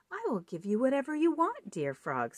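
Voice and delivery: high, quick pleading princess; eager princess. Acted. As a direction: rush the offer to the frog.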